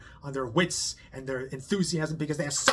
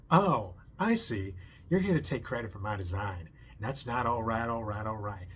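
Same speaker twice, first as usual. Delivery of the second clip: a light southern twang, lower and slowed down; 5 semitones lower than usual. Retold or imitated. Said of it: "he has this southern twang accent".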